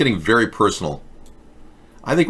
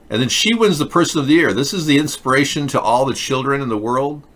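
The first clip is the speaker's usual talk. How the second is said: normal talking voice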